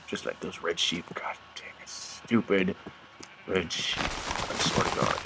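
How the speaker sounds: low, very soft muttered cursing; grumbling under breath. Pretend. Player cursing the red sheep, grumbling under breath. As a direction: mutter darkly, barely audible.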